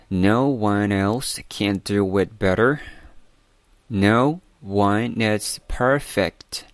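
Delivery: slow, careful English model reading; slow read-aloud. Shown as a demonstration. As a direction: read it out slowly word by word for learners. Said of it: "为大家来读一读". (慢速领读)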